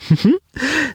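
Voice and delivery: short, high-pitched giggle; little acted chuckle in a high voice; chuckling giggle. A narrator's reading. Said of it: "Daya schien zu glucksen". (kurzes, hohes Glucksen)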